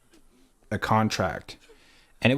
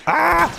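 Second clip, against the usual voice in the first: sharp high yelp of frustration; frustrated yelp; 8 dB louder than usual. Real, not acted. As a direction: cry out in sudden annoyance. Sudden frustration while gaming.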